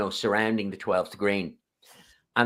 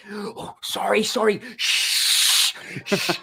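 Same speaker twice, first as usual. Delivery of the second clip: high apology into long, exaggerated shushes; drunk shushing; 8 semitones higher, much slower than usual. Drunk lads apologising and shushing one another, exaggerated drunken shushing. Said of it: "they were absolutely shit-faced".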